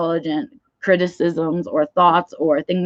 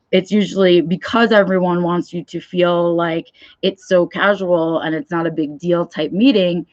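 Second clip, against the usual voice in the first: ordinary talk, nothing marked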